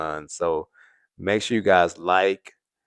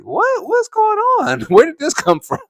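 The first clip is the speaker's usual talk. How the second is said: high, loud mock disbelief; incredulous squeal, played for laughs; baffled reaction; 9 dB louder than usual. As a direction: act out being totally thrown, voice shooting way up. Acted baffled reaction to sudden switch.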